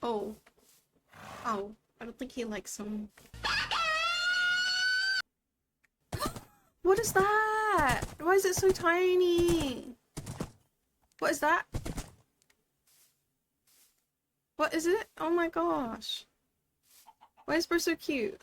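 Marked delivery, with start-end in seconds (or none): 3.3-5.2 long high gasp of delight; huge gasp. Real, not acted. Sees baby horse. 6.8-18.4 cooing excitement. Real, not acted. Cooing at baby horse.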